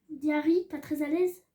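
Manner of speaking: usual speaking voice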